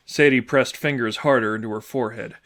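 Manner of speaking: ordinary delivery, nothing marked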